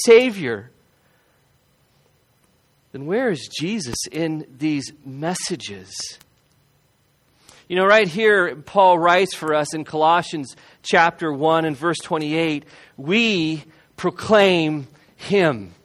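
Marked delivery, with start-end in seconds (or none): 2.9-6.2 then where is Jesus drawn out slow and much softer, "where" lingered on; slow hushed question. Acted. Preacher asking his congregation again, slow and hushed. 13.0-15.7 slow scripture reading. Acted. Preacher reads verse slowly.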